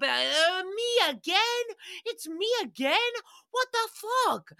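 hammed-up high disbelief; mock Tom Cruise disbelief. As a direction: play him baffled to hear himself again. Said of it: "He's like".

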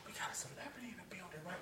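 hushed, fast whisper. Retold or imitated. Officer marvelling at a famous prisoner, whispered. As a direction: whisper it like a secret among cops.

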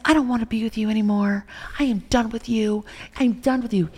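high, loud breakup declaration; emphatic, exasperated re-enactment; retold angry outburst. Retold break-up argument with boyfriend.

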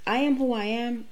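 ordinary delivery

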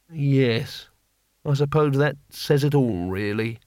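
resigned, subdued teacher; understated weary sadness, softening at the end; sadly. A narrator's reading. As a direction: let it fall away into mild sadness. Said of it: "said Mister Savaloy sadly".

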